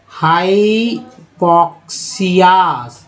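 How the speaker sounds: हाइपोक्सियास spoken very slowly, every syllable stretched; slow read-along. Shown as a demonstration. Teacher reads हाइपोक्सियास slowly for students to copy. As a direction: draw हाइपोक्सियास out for learners to repeat. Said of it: "जो, जिनको नहीं पढ़ने आता वो हमारे साथ पढ़ें".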